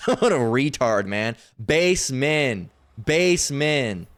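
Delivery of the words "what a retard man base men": usual speaking voice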